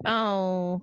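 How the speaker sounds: low deflated groan; mock letdown; crowd disappointment. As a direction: let the crowd's joy drop away. Acted crowd let down by news.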